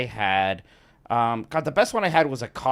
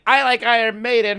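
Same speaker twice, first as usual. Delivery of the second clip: loud; 9 semitones higher, 7 dB louder than usual. Retold or imitated. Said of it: "Now I was like".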